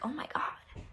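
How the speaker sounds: whispered disbelief, retold; barely voiced, stunned aside. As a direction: breathe it out in a hushed whisper. Stunned reaction in retold conversation.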